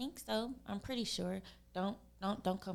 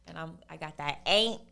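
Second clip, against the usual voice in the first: a hint of Southern drawl, louder; 8 dB louder than usual. Showing the Southern words she picks up, light Southern drawl. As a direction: lean into the Southern drawl on each word. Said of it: "The little southern drawl".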